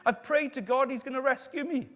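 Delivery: high, loud imitated call; shouting back. Stranded man answers the ship's captain, shouting back high and loud. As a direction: shout back cheerfully refusing, high-pitched. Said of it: "he shouts back to Andrew".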